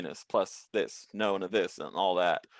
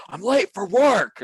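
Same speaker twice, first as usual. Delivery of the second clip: slow, loud and much higher protest; angry retort; 11 semitones higher, 7 dB louder, slower than usual. Retold or imitated. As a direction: shout your excuse back at the cops. Driver arguing with the cops.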